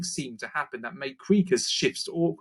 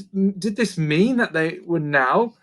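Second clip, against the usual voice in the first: plain reading voice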